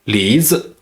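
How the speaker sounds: 梨子 with 梨 rising, 子 brief and light; neutral tone ending. Shown as a demonstration. Teacher demonstrating neutral tone, 梨子 ending short and light. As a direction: lift 梨, then barely touch 子. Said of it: "second syllable is with a neutral tone".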